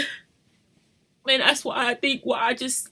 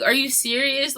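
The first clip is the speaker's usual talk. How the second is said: usual speaking voice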